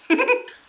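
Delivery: squeaky high giggle; very high laugh. Real, not acted. Reviewer laughing after acting out the audition, high laugh. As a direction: laugh at your own bit.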